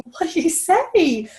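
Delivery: high, slow voiced question; asking the stylist in a high, slow voice; curious question. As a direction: lift it up and draw it out. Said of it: "I said".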